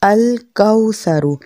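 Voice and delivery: slow demo of कौ in अल कौसरु; rounded aw. Shown as a demonstration. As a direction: say अल कौसरु slowly with कौ as rounded au, not को. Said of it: "लाजमन इसकी practice करें".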